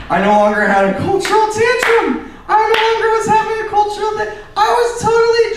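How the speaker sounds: high, loud singsong triumph; excited singsong. Acted. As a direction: sing it out in triumph. Triumphant outburst, half sung.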